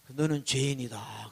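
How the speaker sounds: low, slow, soft voice from within; inner voice returning. Acted. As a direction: intone it clearly and slowly, down low. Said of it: "또 들리는 거예요". (속에서 또렷이 들리는 낮고 느린 음성)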